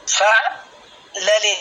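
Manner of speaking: "فعلل" slowly in separated syllables, fa'-lalil; correct pronunciation demo. Shown as a demonstration. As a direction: model "فعلل" for a student, pausing after the first syllable. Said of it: "ليست الطريقة التي نطقتها بها".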